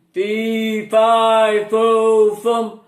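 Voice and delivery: a big giant calling out in his sleep; loud storybook giant. A narrator's reading. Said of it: "muttered the giant in his sleep".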